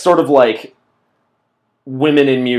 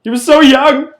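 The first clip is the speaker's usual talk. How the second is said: high wailing grief, hammed-up; mock mourner wail; 14 semitones higher, 7 dB louder than usual.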